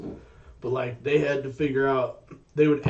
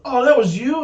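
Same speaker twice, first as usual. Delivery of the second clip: surprised realization; 12 semitones higher, 9 dB louder than usual. Retold or imitated. Realizing the neighbor sent texts.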